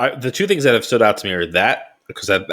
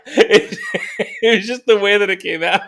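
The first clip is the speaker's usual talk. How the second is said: laughing; 10 semitones higher than usual. Real, not acted. Teasing laugh over a slip.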